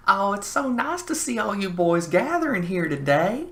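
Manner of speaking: put-on very high, loud old-lady falsetto; older woman. Older woman to the boys at the bar, high mock old-lady voice. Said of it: "there's this older woman. She looks to me and says".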